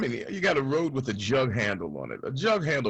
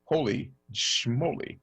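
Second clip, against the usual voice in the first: ordinary talk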